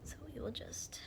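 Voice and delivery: soft whisper while concentrating. Real, not acted. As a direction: whisper it, focused on the task. Concentrating on eyeliner.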